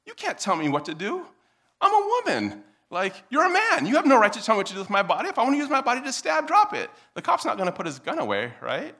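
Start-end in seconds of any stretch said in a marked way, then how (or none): 0.1-6.5 high, loud mock indignation, speeding up; woman's defiant outrage. Armed woman defying the cop, loudly indignant. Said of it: "And if she were like". 6.5-6.9 very high, loud interruption; cop's shouted command. Pretend. Cop cutting off the woman's rant, shouting. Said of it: "a cop comes up, pulls his gun, and is like".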